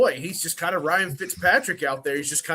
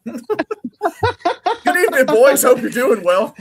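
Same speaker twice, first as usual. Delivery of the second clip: loud laughing greeting; 9 semitones higher, 9 dB louder, much slower than usual. Real, not acted.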